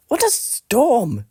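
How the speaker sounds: high, chirpy bird voice; twittering wren. Acted. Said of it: "twitters wren".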